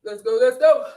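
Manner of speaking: loud call to get moving; loud rally. Real, not acted. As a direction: shout it to rally the class. Coach pushing class on.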